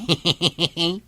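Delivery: short amused laugh; teasing laugh. Acted. Friend laughing at the teasing about his balunot, amused laugh.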